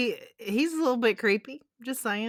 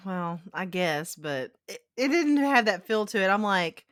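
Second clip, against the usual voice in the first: ordinary talk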